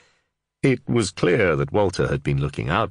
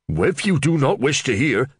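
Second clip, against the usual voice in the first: higher, louder sulky huff; pouting; 9 semitones higher, 4 dB louder than usual. Acted. Offended Reeve sulking at the sergeant, higher and louder. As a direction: sulk like a slighted man. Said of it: "the Reeve pouted".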